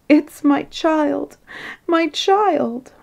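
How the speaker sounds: high, drawn-out acted wail; sobbed. A narrator's reading. Queen grieving over her child, hammed-up sobbing wail. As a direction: lament slowly in a high tearful voice. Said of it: "sobbed Her Majesty".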